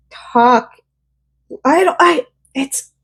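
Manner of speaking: words catch as tears rise; choked up. Real, not acted. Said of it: "I'm getting emotional about it".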